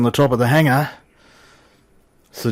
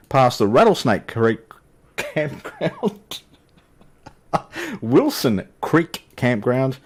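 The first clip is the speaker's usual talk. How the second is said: laughing through names; 3 semitones higher, slower than usual. Real, not acted. Giggling through the place names.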